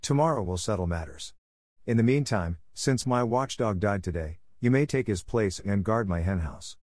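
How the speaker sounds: plain reading voice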